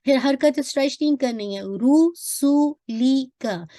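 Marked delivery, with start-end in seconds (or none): 1.8-3.7 रुसूली का slowly, each letter given one count; slow recitation demo. Shown as a demonstration. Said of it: "हर एक को इतमाम हरकत करना है".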